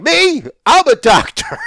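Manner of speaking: high, loud eager "Me? I'm a doctor."; eager fan voice. Retold or imitated. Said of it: "he's gonna be like".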